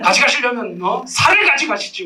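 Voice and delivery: high, comic outburst of indignation; acted anger. Preacher bargains with God to take his fat instead, mock anger. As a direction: haggle furiously in mock outrage, pitch leaping up. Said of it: "막 이러면서 막 분노하는 거예요".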